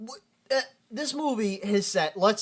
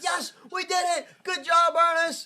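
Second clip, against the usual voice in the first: mock cheering; 12 semitones higher than usual. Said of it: "they're like".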